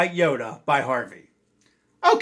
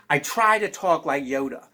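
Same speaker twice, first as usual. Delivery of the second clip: ordinary talk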